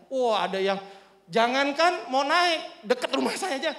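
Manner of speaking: ordinary talk, nothing marked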